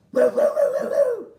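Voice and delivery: high, loud woo woo howl; loud high dog howls. Acted. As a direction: howl like a hound. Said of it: "said Bottomley Potts".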